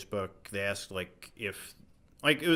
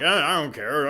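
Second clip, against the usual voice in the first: shouted, higher and louder; loud, defiant; 7 semitones higher, 14 dB louder than usual. Retold or imitated. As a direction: fling it out, drunk and defiant. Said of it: "And I'm like".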